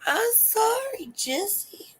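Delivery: very high, drawn-out weepy apology; mock sad crying. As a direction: whimper a pretend tearful apology. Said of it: "All sad and shit, crying, like".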